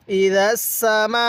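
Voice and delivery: gentle "dh" in "ইদা", strong doubled "s" in "সামা"; slow recitation, hard sin and long final vowel in "সামা"; soft dhal, firm sin. Shown as a demonstration. Teacher showing letter contrast, soft "ইদা" into firm drawn-out "সামা". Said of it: "সিনটা উচ্চারণের সময় একটু শক্তভাবে".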